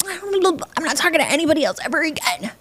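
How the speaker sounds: high-pitched mock tantrum; storming off in a high, put-on huff; riled-up relative. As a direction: whine it like an over-dramatic sore sulker. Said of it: "gets real riled up and real pissed off".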